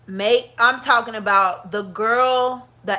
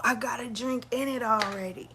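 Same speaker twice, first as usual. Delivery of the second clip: whispered to herself; 8 dB quieter than usual. Real, not acted. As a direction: breathe it out under her breath. Muttering to herself about glass.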